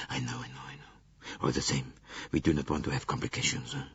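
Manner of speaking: low, soft fretful Frenchman; lightly voiced anxious Bouc; Monsieur Bouc. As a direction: mutter it like a worried railway director. Bouc voice held.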